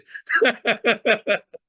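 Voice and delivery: high chuckle; laugh. Real, not acted. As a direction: burst of amused laughter. Amused after teasing the viewer.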